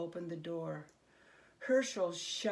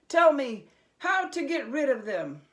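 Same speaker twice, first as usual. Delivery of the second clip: louder, firm and eager; determined demand; 6 semitones higher, 11 dB louder than usual. A narrator's reading. Herschel to the villagers, louder and determined. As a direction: insist on getting the answer.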